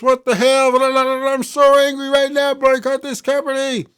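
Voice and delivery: high, loud mocking voice of an outraged fan; mocking whiny fan. Reading a fan comment aloud, mocking it as a high, whiny rant.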